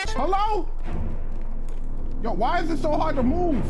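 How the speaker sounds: high startled outburst; shocked and rattled, calling out. Real, not acted. Startled by jumpscare voice.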